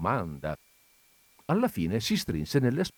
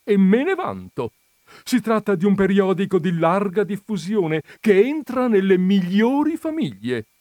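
high, loud, haughty boasting; proud boast; 10 semitones higher, 7 dB louder than usual. A narrator's reading. Countess praising her magazine to the visitor, proud. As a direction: declare it with full pride. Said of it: "disse la contessa con fierezza".

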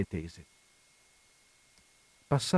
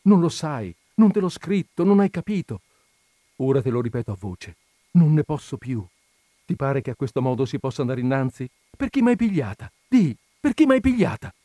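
rising, loud accusation, higher and higher; angry outburst; 4 semitones higher, 5 dB louder than usual. Acted. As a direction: she can't take it anymore, let it out. Woman bursts out at lover. (sfogo rabbioso, sempre più alto e forte)

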